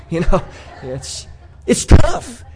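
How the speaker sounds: laugh, then a slow drawn-out "tough"; amused sigh after the joke. Real, not acted. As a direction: chuckle through it and stretch it out. Laughs at own punchline.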